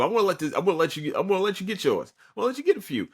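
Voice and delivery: high, teasing, condescending offer; mocking generosity. Korosuchi taunting Pernita, mock generous and high. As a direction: dangle it like a cat with a mouse. Villain toys with his opponent.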